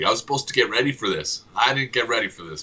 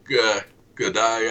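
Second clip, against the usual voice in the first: mock Scottish; slower than usual.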